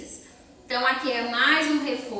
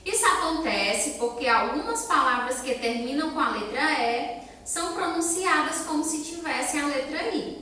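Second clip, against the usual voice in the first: usual speaking voice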